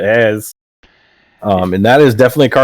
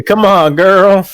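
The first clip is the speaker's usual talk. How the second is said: 1 mock exasperation; 10 semitones higher, 6 dB louder, much slower than usual. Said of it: "It could be a".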